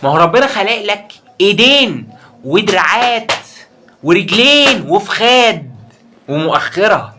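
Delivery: high, loud and dragged out; exasperated slow rant. Real, not acted. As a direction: spell it out slowly in disbelief. Ranting at a scared viewer. (تأنيب بصوت عالي وبطيء)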